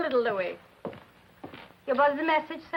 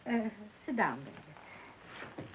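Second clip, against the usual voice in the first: breathy near-whisper, much softer; hushed; 2 semitones lower, 13 dB quieter, much slower than usual. Acted. Mother hushing son before bad news, whispering. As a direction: ease him into bad news in a hush.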